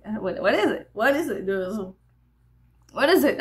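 retold skittish question, building in volume. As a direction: act out being nervous of it, repeating yourself. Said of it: "I was very skittish".

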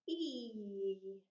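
E held as a steady, stretched ee; long vowel E. Shown as a demonstration. Teacher gives a listening task, E stretched out. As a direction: sustain the letter name E. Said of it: "The long vowel sound E".

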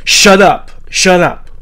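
loud, high-pitched yell; screamed order. A narrator's reading. Catherine turns on the screaming little brother, yelling. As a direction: yell it twice, the first hardest. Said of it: "turned back to him and screamed".

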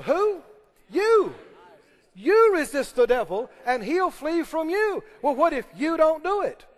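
preaching shout. Real, not acted. Preacher pressing the congregation, emphatic shout. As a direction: drive home YOU.

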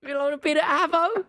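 high put-on Aussie accent; Australian accent. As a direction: ask it as a high-pitched imitation Australian. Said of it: "Can you say more than just Big Ange?".

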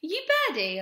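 high, loud teacher's question; imitated maths teacher. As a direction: imitate a teacher asking, pitched right up. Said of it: "came up to me going".

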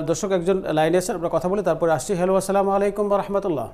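usual speaking voice

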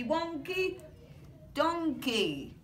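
ordinary delivery

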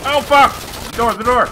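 panicked yell. Real, not acted. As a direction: yell in real alarm. Door fails, caught outside under fire.

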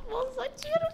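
squeaky high mock plea to the woman, half laughing. Passenger begging the woman to pull the bag off, squeaky high. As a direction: pitch way up like a panicked passenger.

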